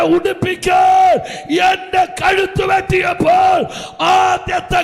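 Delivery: high, loud revival preaching with call-and-answer; preacher thundering about the first cry in Eden; preaching at full force. Acted. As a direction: shout the questions at the congregation.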